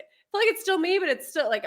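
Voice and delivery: normal talking voice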